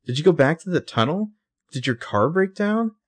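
high, worried questioning; acted concern, voice lifted. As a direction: imitate a worried friend, pitch climbing with each question. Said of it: "She's very concerned".

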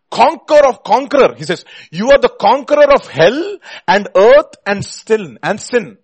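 loud, high, ringing proclamation; full-force preaching. Real, not acted. Preacher declaiming Wesley's hymn to the congregation, full force. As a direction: hammer out each title at full voice.

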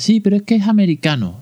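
high, sarcastic voice playing a doubter; ironic mock objection. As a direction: squeak out the silly objection to mock it. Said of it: "Permitidme la ironía".

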